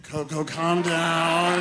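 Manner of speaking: loud, very slow imitated plea; strained calming. Keeper tries again to settle Coco, strained. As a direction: call it out loudly, dragging the last word. Keeper's louder attempt to calm gorilla.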